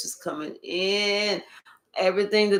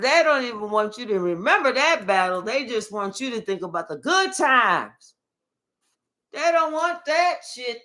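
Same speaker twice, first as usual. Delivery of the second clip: normal talking voice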